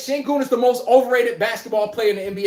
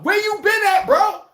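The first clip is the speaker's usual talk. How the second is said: excited greeting; 11 semitones higher than usual. Acted. Greeting an old acquaintance.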